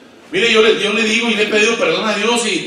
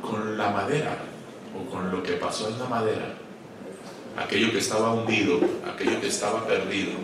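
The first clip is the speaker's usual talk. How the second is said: quiet intimate preaching; 10 semitones lower, 10 dB quieter than usual. Acted. Preacher closing his point to pastors, low and soft. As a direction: draw them in with a hush.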